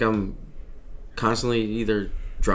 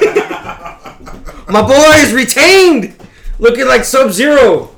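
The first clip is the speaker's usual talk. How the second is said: loud laughing hype call; excited shout; 12 semitones higher, 11 dB louder, slower than usual. Real, not acted. Excited wrestling pick call-out.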